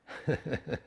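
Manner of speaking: brief amused chuckle; short laugh. Real, not acted. Meditation teacher amused by own grammar aside, short chuckle.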